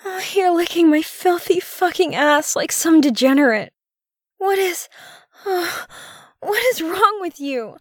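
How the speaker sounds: very high, moaning disbelief; shocked gasping. Acted. Woman reacts to her partner, shocked and breathless. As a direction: gasp the words out in a high voice.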